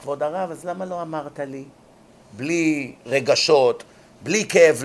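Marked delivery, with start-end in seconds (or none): 0.0-1.6 soft, unbothered, detached; indifferent calm. Retold or imitated. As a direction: ask it coldly, without feeling. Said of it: "הוא היה נשאר אדיש". (אדיש ושקט, בלי רגש)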